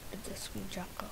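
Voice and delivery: unvoiced whisper. Real, not acted.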